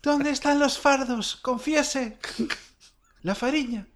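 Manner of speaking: high, slowed squeaky interrogator; thin reedy voice. Retold or imitated. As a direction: ask it high and thin, like a piping judge. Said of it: "Garzón tiene un timbre de voz muy particular".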